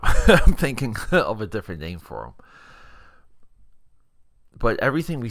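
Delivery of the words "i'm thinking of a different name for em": laughing. Real, not acted. Laughs at own silly name.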